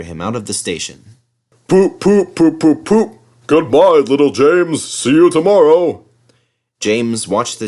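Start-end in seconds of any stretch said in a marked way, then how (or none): 1.7-3.1 loud, high poop toots; horn toots. A narrator's reading. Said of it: "he puffed". 3.5-6.0 loud, high taunting goodbye; mocking farewell. A narrator's reading. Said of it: "he puffed".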